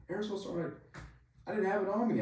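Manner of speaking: high-pitched mock yell; imitated yell. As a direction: call up over helicopter noise. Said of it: "trying to yell over the helicopter".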